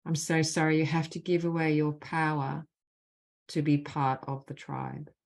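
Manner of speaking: measured, deliberate leading of a phrase; guided healing phrases. Acted. Facilitator gives the group apology phrases to repeat, measured and deliberate. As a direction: lead the group line by line, leaving space after each.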